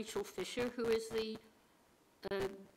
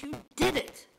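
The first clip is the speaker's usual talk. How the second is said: excited caller; 4 semitones higher, 7 dB louder than usual. Retold or imitated. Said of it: "this lady is very excited".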